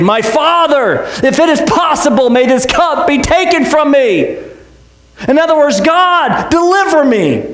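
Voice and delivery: high, slowed, straining plea to the Father; anguished prayer. Acted. Pastor voicing Jesus praying in the garden, anguished and pleading. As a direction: cry out as if you cannot stand.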